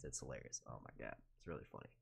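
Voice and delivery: ordinary talk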